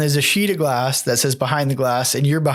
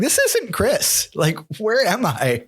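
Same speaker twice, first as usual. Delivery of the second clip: confused; 9 semitones higher, slower than usual. Retold or imitated. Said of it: "I'm like".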